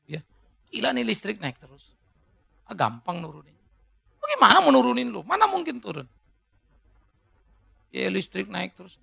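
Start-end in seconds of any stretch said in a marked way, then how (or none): 4.2-6.1 mock wife retort. Wife's retort voiced in joke.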